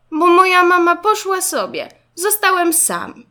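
put-on upset child. A narrator's reading. Zygzak telling classmate mama left him, plaintive. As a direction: wail your grievance to a new friend. Zygzak explains why he cries.

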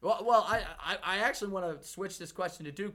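ordinary talk, nothing marked

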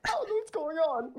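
high, bewildered squeak; confused panic. Retold or imitated. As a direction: voice the lost recruit's panic. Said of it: "I'm like".

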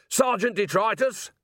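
high-pitched call for the sergeant; summoning shout. A narrator's reading. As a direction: summon a subordinate urgently.

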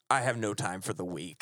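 low, curt dismissal; cold doctor. Retold or imitated. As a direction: drop the voice and dismiss them. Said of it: "he's like".

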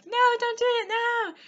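high, loud begging for life; mock panicked plea. Victim pleads before being shot.

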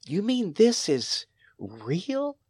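put-on hushed amazed girl asking if it's real; astonished question. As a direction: ask it in stunned wonder.

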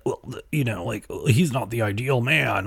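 lightly voiced mock of Ken critics; mocking quote. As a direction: parody the prissy complainers. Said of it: "they're like".

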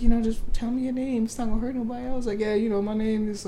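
put-on high prying girly voice; imitating a nosy woman on the Spaces; pushy woman. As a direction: coax the name out of him, sweetly pushy. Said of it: "she was like".

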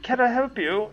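high pinched nasal hostess; nasally voice. Acted. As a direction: squeeze it through a blocked nose. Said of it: "in a nasally voice you imagine is augmented by the nasal passages being closed".

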